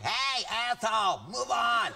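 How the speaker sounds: a hint of a rude New Yorker; lightly voiced New Yorker. Comic imagining New York crowds heckling his gigs, mock-brusque. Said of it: "they're like, New York".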